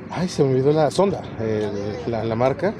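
normal talking voice

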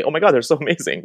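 a high, fast, lightly mocking "oh my God"; mock gushing praise. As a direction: gush over it in a half-mocking way. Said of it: "Like, we say".

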